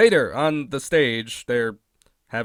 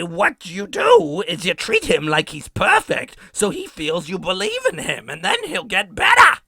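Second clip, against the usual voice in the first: Marge-style advice; 12 semitones higher, 7 dB louder than usual. Retold or imitated. Marge gives mother-style advice.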